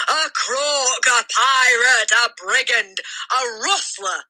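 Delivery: high, building insults; raging list. A narrator's reading. The headmistress rants accusations at the boy. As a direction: pile the names on in fury. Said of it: "the Trunchbull screamed".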